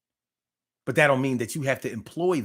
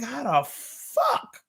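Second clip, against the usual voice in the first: retold amazement; 4 dB quieter than usual. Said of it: "I was like".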